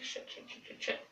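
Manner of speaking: breathless frightened whisper under her breath; panicked whisper. Retold or imitated. Surrounded by girls holding knives to her neck, a terrified whisper. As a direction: whisper the swearing in terror, barely voiced.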